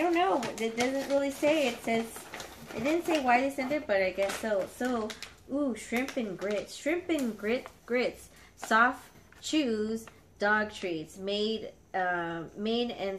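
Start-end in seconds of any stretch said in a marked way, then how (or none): none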